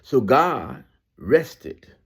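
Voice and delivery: very slow, drawn-out reading; slow scripture reading. Acted. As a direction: linger on every word. Rereads the verse slowly.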